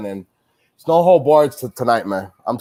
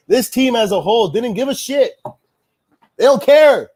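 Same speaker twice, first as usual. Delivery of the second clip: high-pitched rant building to a shout; exasperated outburst; 8 semitones higher, 3 dB louder than usual. Real, not acted. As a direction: let the frustration burst out loud at the end. Fan fed up with losing team.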